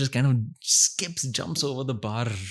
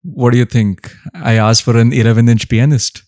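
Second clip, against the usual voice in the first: punchline deadpan; 8 dB louder than usual. Retold or imitated. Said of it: "looks at him and says".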